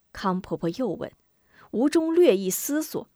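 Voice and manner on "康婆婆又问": ordinary delivery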